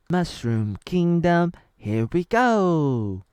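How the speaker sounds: slow, loud, drawn-out cheerful Mario call; mock Mario catchphrase. Fan imitating Mario arriving in the kingdom, slow loud hammed-up call. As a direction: stretch it out like a game hero announcing his arrival.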